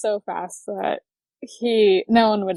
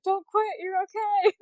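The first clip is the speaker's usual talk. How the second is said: higher-pitched coaxing friend; mock soothing friend; 10 semitones higher than usual. Friend talking her out of quitting, high mock coaxing. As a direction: coax her gently off the ledge. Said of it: "I'm like".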